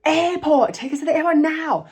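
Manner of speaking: very high-pitched imitation screaming; mock scream. Passengers demand the airport from taxi driver, mock screaming. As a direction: scream the demand in a high voice, held back in volume.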